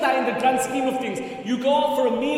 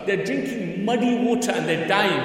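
ordinary delivery

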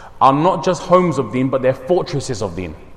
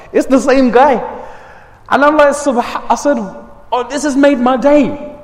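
higher, louder, overjoyed; delighted surprise; 9 semitones higher, 3 dB louder than usual. Real, not acted. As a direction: burst out with happy surprise. Surprise at the door.

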